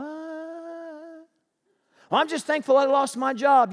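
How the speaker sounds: high, sing-song pious voice; mock pious believer. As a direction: play a too-cheerful believer. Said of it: "they say".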